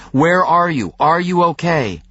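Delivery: frantic shouted plea over the phone. A narrator's reading. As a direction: demand an answer in sudden panic. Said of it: "suddenly frantic".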